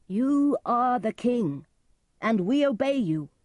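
slow, high, projected declaration; leader placating the king. A narrator's reading.

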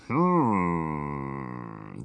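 low, soft, lingering hum; a soft hum of sympathy; sympathetic hmm. A narrator's reading. Judge hearing the orphans' story, sympathetic. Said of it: "a sympathetic kind of hmm".

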